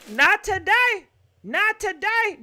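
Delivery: loud, high hype: not today; hyped declaration. Real, not acted. Host hypes up the caller to the audience, loud and triumphant. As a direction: call it out like a victory chant.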